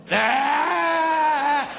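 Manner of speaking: long high exasperated scream; acted-out wordless wail of frustration; exasperated yell. Homeowner losing it at burglar, exasperated yell.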